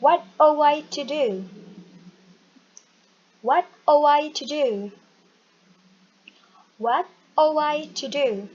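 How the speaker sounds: no G, H or T in "ought"; silent GH T. Shown as a demonstration. Teacher showing the class how to read ought. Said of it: "tidak perlu dibaca G H T-nya".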